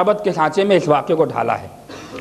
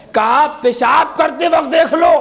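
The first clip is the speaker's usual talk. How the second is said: preaching at full force; 6 semitones higher than usual. Acted. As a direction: ring out each answer to the crowd. Ali's answer preached loud.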